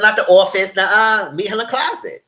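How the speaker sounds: high, loud, insistent objection; emphatic mock correction. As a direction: cut in with an animated retold correction. Acted correction of meeting place.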